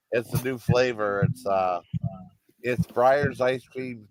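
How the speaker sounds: usual speaking voice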